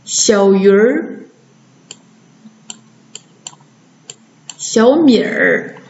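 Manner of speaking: slow 小鱼儿, 小米儿 with r endings; erhua demo. Shown as a demonstration. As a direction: draw out 小鱼儿 and 小米儿, 儿 blended in. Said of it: "直接加儿一起读".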